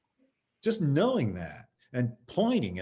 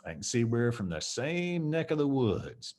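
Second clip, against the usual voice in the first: slow calm line. Shown as a demonstration. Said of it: "look over at him and go".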